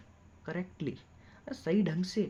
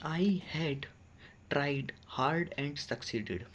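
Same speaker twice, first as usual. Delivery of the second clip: slow dictation; much slower than usual. Shown as a demonstration. Teacher dictates slowly.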